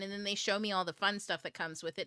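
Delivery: ordinary talk, nothing marked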